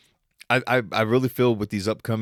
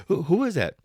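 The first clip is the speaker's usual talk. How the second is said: imitating curious mom; 8 semitones higher than usual. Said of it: "when my mom does that".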